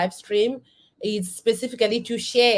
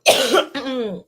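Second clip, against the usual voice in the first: loud hacking cough; a real coughing fit; 4 semitones higher, 4 dB louder than usual. Real, not acted. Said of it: "I'm coughing so bad".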